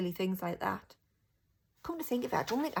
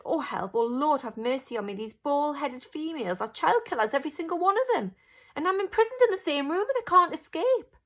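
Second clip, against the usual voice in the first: from worried to frantic, higher and louder; panicking more with every line; a boy trapped in a room of witches, panicking; rising panic; 8 semitones higher, 7 dB louder than usual. A narrator's reading. Boy's rising panic in thought.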